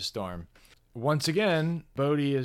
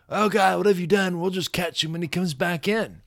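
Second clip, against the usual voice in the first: Australian cop; 6 semitones higher, 5 dB louder than usual. Retold or imitated. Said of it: "the cops run up to the surf and like".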